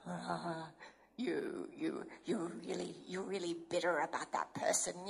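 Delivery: mock sneaky devil voice; the enemy. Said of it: "the enemy comes, he goes".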